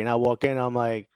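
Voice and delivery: ordinary delivery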